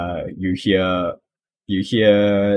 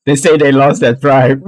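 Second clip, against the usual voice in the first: higher and louder, shrugging it off; Mick's shrugging voice; 6 semitones higher, 8 dB louder than usual. Acted. Mick shrugs while telling the kobolds. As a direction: toss the line out with a shrug. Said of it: "in the way Mick speaks".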